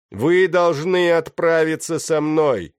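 slow, high, loud proclamation. A narrator's reading. Pirate to all the captives, raising his voice. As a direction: project so everyone can hear. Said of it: "повышая голос, чтобы услышали все".

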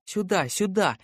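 put-on high-pitched, eager child's call; excited girl calling. A narrator's reading. Girl urging the adults through the tunnel, excited calling. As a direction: call out excitedly like a little girl, without real shouting. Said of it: "возбуждённо кричала она".

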